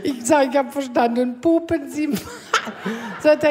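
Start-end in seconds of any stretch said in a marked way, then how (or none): 0.0-2.2 high, laughing question; high giggling disbelief; laughing through words. Retold or imitated. Laughing retelling to doctor.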